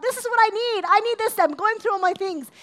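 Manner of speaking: high, loud, urgent holler; shouting out demands. Retold or imitated. People praying to God with demands, shouting. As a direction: shout out a list of needs. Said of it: "And we, like, shout out".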